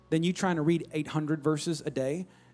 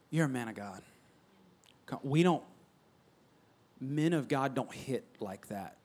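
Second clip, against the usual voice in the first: soft, much quieter praise of the son; gentle encouragement; 5 semitones lower, 9 dB quieter than usual. Shown as a demonstration. Parent softly affirming son, the better way. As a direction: lift the boy up tenderly, low volume. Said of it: "You see the difference?".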